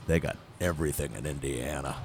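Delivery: low, hushed insider's tip; retold secret, dropped low and soft; leaning in confidentially. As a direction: lean in and confide it quietly. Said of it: "he leaned into me and he said".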